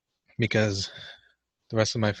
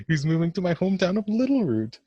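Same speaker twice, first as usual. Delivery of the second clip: mock yell; 9 semitones higher, 4 dB louder than usual. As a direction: read the line out as a high, loud playful yell. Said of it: "So I guess I gotta yell it".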